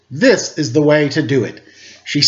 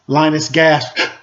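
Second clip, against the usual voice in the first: usual speaking voice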